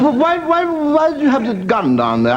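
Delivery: high, slow, prissy English voice; a nervous prim gent asking carefully; posh Englishman. Acted. Englishman to farmer, high wary prim query. Englishman asks about gun.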